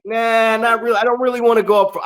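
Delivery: high, fast brush-off; quick refusal. Retold or imitated. Veteran turning down big bumps to a partner, high and fast. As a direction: wave off a risky move, rushed. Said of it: "I'm like".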